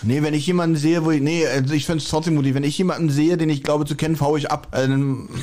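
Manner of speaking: ordinary talk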